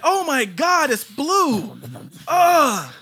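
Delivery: loud high dismayed cry; frantic mother. Retold or imitated. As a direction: shriek it like a stressed mother. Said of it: "She's like".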